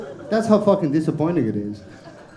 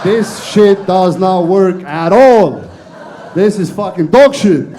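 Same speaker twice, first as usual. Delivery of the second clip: loud and slow, each word hammered; frustrated shout; 7 dB louder, slower than usual. Acted. Complaining to the crowd that his accent fails, slow loud shout. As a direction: yell it out like you are fed up.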